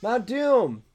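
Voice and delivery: high, loud mock yell; yelled safe word. Comic hobbits yelling their safe word, high mock yell. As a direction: shout the safe word twice. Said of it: "one of them yells".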